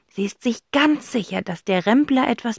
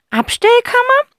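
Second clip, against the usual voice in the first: put-on high, loud old lady's question; a bright idea from the aunt; old aunt suggesting; 8 dB louder than usual. Aunt Rotz suggests the storeroom to the twins.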